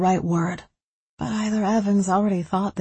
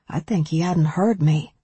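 plain reading voice

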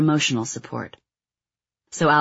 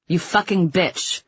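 slow, higher-pitched insult, understated; restrained narrated yell; 5 semitones higher, slower than usual. A narrator's reading. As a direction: read a yell without really raising the volume. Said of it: "Urschler yelled".

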